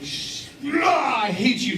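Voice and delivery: hammed-up groan sliding into a complaint; playful imitation of a disgruntled crowd; mock exasperation. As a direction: groan high, then grumble in mock hatred. Voices the congregation's grumbling reaction.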